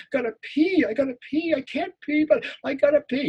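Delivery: very high, frantic worry; a terrified boy desperate for the bathroom; panicked inner voice. Acted. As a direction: squeak it in a panic.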